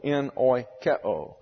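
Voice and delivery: slow, careful en oikeo, each syllable apart; teacher spells the Greek verb, then says it slowly; careful spelled-out word. Shown as a demonstration. "en oikeo" said slowly and clearly, syllable by syllable. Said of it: "That's E N O I K E O".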